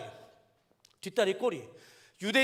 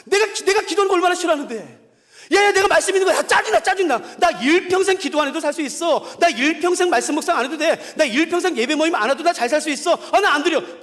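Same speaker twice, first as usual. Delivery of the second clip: higher, louder whiny complaint, half laughing; 4 semitones higher, 5 dB louder than usual. Pretend. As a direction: play a fed-up believer griping that he won't pray. Voicing an unwilling believer. (기도 싫다고 투덜대는 신자 흉내)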